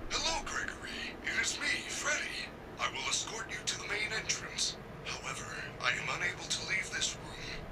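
high, breathy robot whisper. Acted. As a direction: whisper the secret message. Robot sends encoded whisper.

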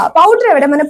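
ordinary delivery

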